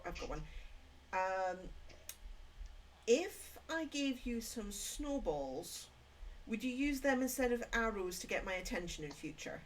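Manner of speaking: ordinary talk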